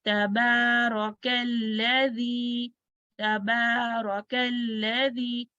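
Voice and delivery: slow, level recitation of तबारकल्लज़ी with held long vowels; two-count elongation. Shown as a demonstration. Teacher shows students the two counts, elongated recitation. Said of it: "two counts".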